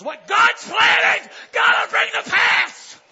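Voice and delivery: high, slow, full-force shout; triumphant preaching. Real, not acted. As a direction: hammer out each stretched word loudly. Sermon climax shouted.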